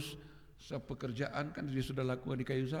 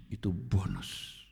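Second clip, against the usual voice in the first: ordinary talk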